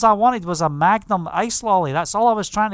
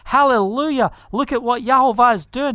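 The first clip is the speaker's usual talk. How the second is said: rejoicing; 3 semitones higher, 4 dB louder than usual. Retold or imitated. Healed woman dancing before her friends, rejoicing. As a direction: celebrate out loud as the healed woman. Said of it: "She's just rejoicing".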